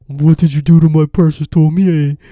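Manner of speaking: very loud, high cartoon henchman wail; shrieking mock-devoted lackey voice; hammed-up goon lackeys. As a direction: wail it like an over-the-top minion. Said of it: "They talk just like that".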